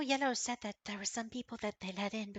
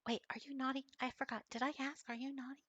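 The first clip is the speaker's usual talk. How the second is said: worried kitten; 5 semitones higher, 4 dB louder than usual. Acted. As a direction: fret like a small kitten. GM voices the naughty-worried kitten.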